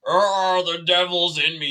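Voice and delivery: high, loud mock distress; hammed-up plea to the priest; mock possessed parishioner. Parishioner begging the priest about the devil, mock alarm. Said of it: "they're like".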